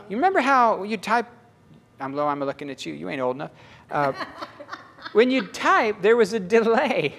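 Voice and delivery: usual speaking voice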